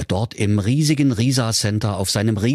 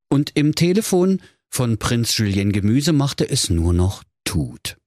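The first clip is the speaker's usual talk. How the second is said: plain reading voice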